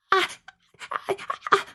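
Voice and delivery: high breathy gasps of fear; scared little gasps; frightened gasps. Acted. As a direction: gasp out in fright. Frightened gasps in fairy tale.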